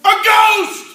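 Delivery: terrified scream. Acted. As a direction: scream in panic at the shape on the water. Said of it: "one of the fellas screamed".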